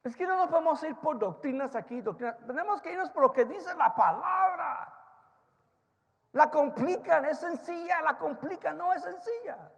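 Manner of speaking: loud, high, insistent sermon shout; shouted preaching. Acted. Preacher warning his church, shouting. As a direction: hammer the point at the congregation.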